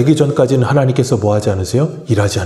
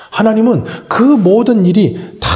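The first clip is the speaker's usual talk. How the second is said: ordinary talk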